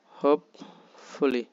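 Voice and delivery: হোপফুলি slowly, as হোপ, then ফুলি; split into syllables. Shown as a demonstration. Teacher breaking হোপফুলি into parts, slowly separated. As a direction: put a clear gap inside হোপফুলি. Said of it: "দুই ভাগে".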